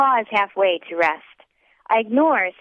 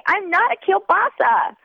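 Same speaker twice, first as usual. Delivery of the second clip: put-on high drunken protest; drunk whine; 12 semitones higher than usual. A narrator's reading. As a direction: whine it tipsy.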